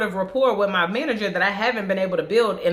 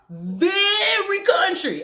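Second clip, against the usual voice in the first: country drawl; 13 semitones higher, much slower than usual. Retold or imitated. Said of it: "I love my girl".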